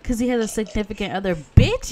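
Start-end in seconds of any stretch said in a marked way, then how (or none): 1.4-1.9 sudden loud indignant Bitch; outraged yell. Real, not acted. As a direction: blurt it out in outrage. Outraged at rejection.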